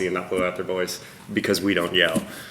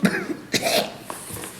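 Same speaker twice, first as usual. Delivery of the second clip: fake hacking cough; 4 dB quieter than usual. After blowing out voice.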